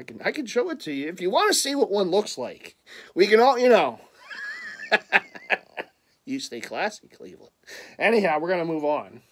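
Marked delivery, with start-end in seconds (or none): 4.3-5.8 laugh. Real, not acted. Laughs at own championship boast.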